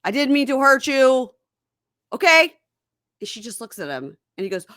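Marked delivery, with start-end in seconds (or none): 0.0-2.5 mock placating husband. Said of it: "So he's like".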